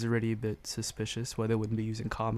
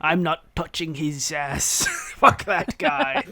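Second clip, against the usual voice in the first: slow, higher, laughing refusal; slow, amused character voice; grumpy character; 4 semitones higher, 8 dB louder, slower than usual. Acted. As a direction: refuse with a disgusted chuckle.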